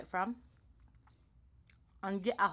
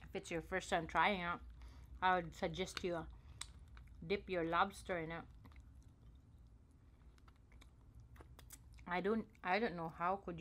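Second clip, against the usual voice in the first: chewing while talking; speaking around food; mouth full; 7 dB quieter than usual. Real, not acted. Seen 0: Puts food in mouth at -1.0; lips work around food while talking.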